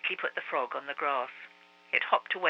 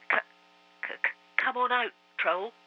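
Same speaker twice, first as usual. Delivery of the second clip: fast, stammering, forced courage; stammering bravado; 7 semitones higher than usual. A narrator's reading. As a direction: sound brave but stutter with fear. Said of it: "as bravely as he could".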